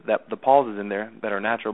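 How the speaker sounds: normal talking voice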